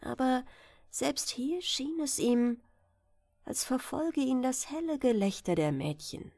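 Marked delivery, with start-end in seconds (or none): none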